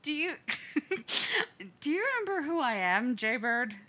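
high, loud and fast, breaking into laughter; can't stop giggling while asking; giggling through words. A narrator's reading. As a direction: brother cracking up filming his drugged sibling. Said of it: "coughs around a giggle".

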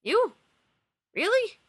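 can't believe it's him; rising stunned disbelief; bewildered surprise. Acted. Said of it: "his bewilderment and surprise".

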